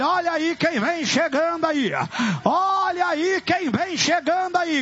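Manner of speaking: high, urgent deliverance preaching; exorcism preaching. Real, not acted. Pastor warning who is arriving, full-force exorcism preaching.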